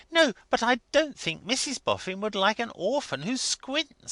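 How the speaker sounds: a hint of a high earnest wife; lightly voiced fussy wife. A narrator's reading. Wife objecting to the orphan, lightly high-voiced.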